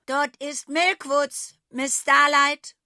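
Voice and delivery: Milkwoods slow, loud and firm; firm, slow answer. A narrator's reading. Sausi tells the goat her goal, slow and firm. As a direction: stand your ground slowly.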